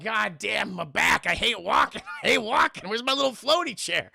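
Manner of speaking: mock grumbling little Yoda; groaning complaint. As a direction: gripe like a sore, put-out Yoda, rising. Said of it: "Oh, just groaning".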